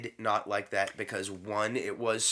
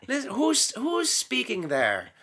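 higher, slow teacherly nun; imitating the nun; 6 semitones higher than usual. Nun explaining the prayer to kids, high and slow. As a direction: lecture the children slowly as the nun. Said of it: "so she went through and going".

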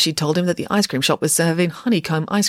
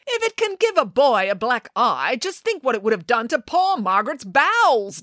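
declaring; 7 semitones higher than usual. A narrator's reading. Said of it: "declared Nanny Piggins".

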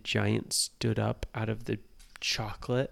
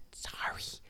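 whispered; 8 dB quieter than usual. Acted. Nora apologizing by the giant.

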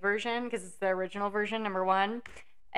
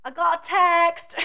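higher, louder, drawn-out British exclamation; British accent; 8 semitones higher, 7 dB louder, slower than usual. Retold or imitated. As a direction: announce it like a reality-show islander. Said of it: "they just go".

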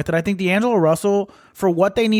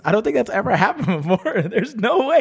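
laughing; 6 semitones higher than usual. Real, not acted. Laughing in disbelief at trade.

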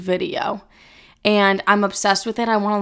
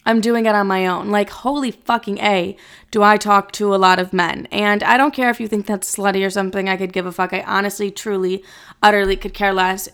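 ordinary delivery

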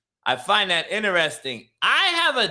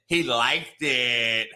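slow, lower, dragged-out punchline; deadpan reveal; 7 semitones lower, much slower than usual. Real, not acted. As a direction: let the words land slowly for effect.

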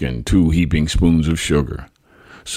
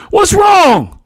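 very high, loud desperate plea; pleading shout; 8 dB louder than usual. A narrator's reading. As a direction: beg to know what happened. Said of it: "he pleaded".

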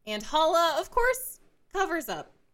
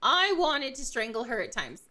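ordinary delivery

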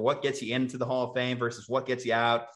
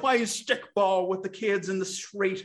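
put-on old man voice; 6 semitones higher than usual. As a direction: reminisce like a cranky old-timer. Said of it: "old man impersonation".

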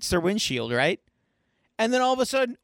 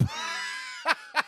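very high shrieking laugh; soft high squeal of laughter; 7 dB quieter than usual. Real, not acted. Laughing at Elmer Fudd gag.

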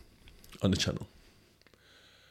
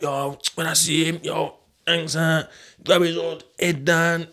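higher, hyped-up boast, slowing at the end; mock a friend bragging about what he'll do; imitating the mandem; 7 semitones higher, slower than usual. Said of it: "they're talking about".

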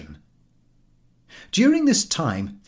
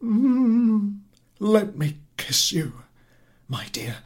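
sleepy husband; 11 semitones higher, 3 dB louder, slower than usual. Acted.

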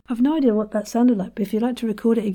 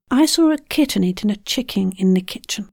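ordinary talk

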